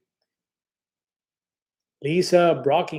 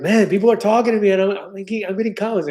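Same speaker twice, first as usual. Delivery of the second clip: excited; 6 semitones higher, faster than usual. Retold or imitated. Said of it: "when I'm like".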